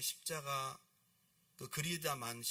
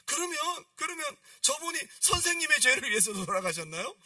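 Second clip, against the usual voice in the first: very high, loud acted urgency; point-blank question; 13 semitones higher, 11 dB louder than usual. Weeping girl confronting the painter, point-blank. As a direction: put the question straight at them, high and pressing. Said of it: "단도직입적으로 물어보는 거예요".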